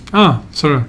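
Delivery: normal talking voice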